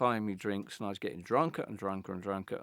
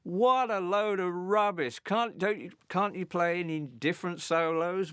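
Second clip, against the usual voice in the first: higher, louder mock heckle at the band; heckling scream; 8 semitones higher, 4 dB louder than usual. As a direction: jeer at the stage like a drunk in the crowd. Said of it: "I'm just screaming at the band".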